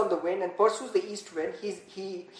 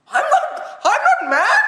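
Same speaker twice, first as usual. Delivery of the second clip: laughing; 5 dB louder than usual. Retold or imitated. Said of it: "How evil Satan is".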